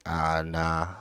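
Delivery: low, soft, lazy refusal; dismissive brush-off. Retold or imitated. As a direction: shrug it off like you can't be bothered. Admin refusing to act.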